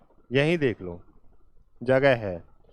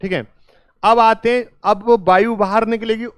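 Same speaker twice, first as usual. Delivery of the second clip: ordinary delivery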